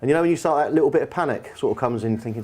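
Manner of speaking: ordinary talk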